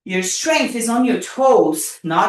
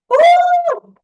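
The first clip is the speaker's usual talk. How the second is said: a high, loud whoop; excited whoop; 5 dB louder than usual. Real, not acted. Coach whooping loud and high mid-workout. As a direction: cheer it out over the burn. Cheering through hard set.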